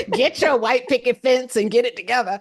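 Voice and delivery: loud and high, laughing through it; laughing while talking. Retold or imitated. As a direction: laugh while imitating the father. Mocks father's advice, laughing.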